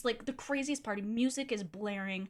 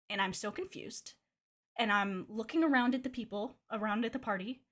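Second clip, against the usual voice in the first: ordinary talk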